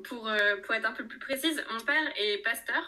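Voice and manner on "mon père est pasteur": usual speaking voice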